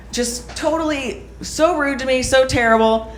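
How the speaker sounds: normal talking voice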